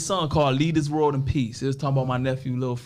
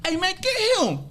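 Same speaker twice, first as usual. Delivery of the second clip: high-pitched mock police shout; retold police yell. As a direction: imitate an officer calling out to grab someone. Police yelling orders, retold.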